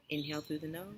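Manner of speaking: soft, unhurried meditation cues with long pauses; calm, hushed breath-by-breath guidance; guided breathing meditation. Acted. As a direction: gently lead a breathing exercise, leaving room for each breath. Leads a guided breathing exercise.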